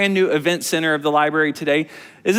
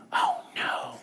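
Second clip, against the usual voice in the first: soft unvoiced whisper; 10 dB quieter than usual. Teacher whispers oh no to the kids. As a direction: breathe it out worried.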